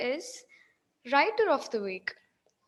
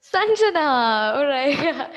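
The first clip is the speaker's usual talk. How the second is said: hyped giveaway announcement; 7 dB louder, much slower than usual. Real, not acted. Random winner revealed after spin.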